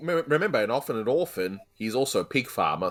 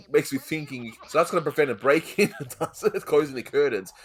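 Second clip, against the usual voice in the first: ordinary talk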